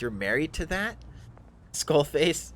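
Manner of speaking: incredulous drow. Acted. Drow questions Skullface about the marriage, incredulous. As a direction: ask it in appalled disbelief. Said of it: "and he goes".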